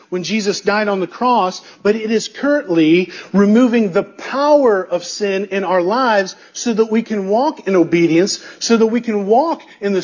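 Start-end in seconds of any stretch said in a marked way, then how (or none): none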